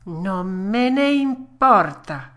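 slow, loud, high puppet retort; emphatic defiance. Acted. Puppet shrugging off death threat, slow loud defiance. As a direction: drag out each word stubbornly. Pinocchio defies the fairy, more emphatically.

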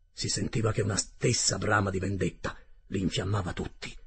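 ordinary delivery, nothing marked

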